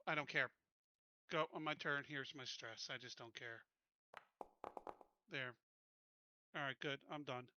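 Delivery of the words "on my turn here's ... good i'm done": ordinary delivery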